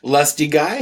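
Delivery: ordinary talk, nothing marked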